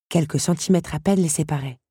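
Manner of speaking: ordinary delivery, nothing marked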